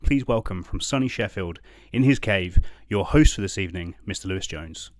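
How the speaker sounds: big showman intro, loud; announcer voice. Pretend. Host announcing himself to the quiz, booming announcer. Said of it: "announces himself as the host".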